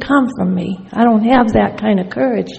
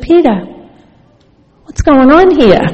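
raised, louder challenge to a friend; insistent, higher-pitched demand; 3 semitones higher, 5 dB louder than usual. Retold or imitated. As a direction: demand an explanation, pitched up and loud. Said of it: "I demanded to know".

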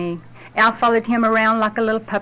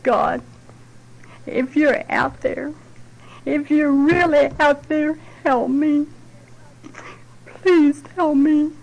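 pleading; 5 semitones higher, slower than usual. Retold or imitated. Desperate prayer in the car.

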